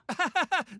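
high, loud manic cackle; manic laugh. Acted. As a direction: cackle like a madman. Ein irres Lachen.

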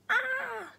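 high sharp yelp; cry of pain. A narrator's reading. Jack cries out sliding down the burning rope, high yelp. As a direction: yelp as your hands burn. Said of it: "cried the boy".

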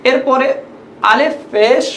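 ordinary talk, nothing marked

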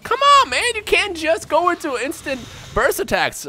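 lightly put-on high whiny voice, slow; mock whiny complaint. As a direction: whine at the opponent in a silly voice.

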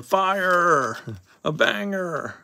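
high, drawn-out put-on cheer; mock hype yell. Card breaker showing how to hype pulls, high mock yell. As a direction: yell it out for show, stretching the words. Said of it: "Gotta yell out".

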